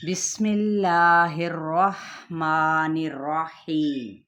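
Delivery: very slow, chanted recitation voice; slow Quran recitation. Shown as a demonstration. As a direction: intone it slowly, letter by letter. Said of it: "دیکھ لیتے ہیں".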